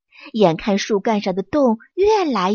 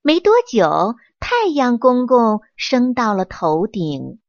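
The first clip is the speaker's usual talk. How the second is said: ordinary delivery, nothing marked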